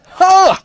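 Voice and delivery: loud, high, hammed-up gasp; starstruck gasp. Zuckerberg hearing Mueller on the phone, mock starstruck gasp. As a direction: gasp hugely as if thrilled.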